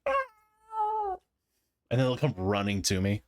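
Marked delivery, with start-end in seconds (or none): 0.1-1.2 cat howl back. Retold or imitated. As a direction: answer the cat with its own howl. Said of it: "And I'll respond like".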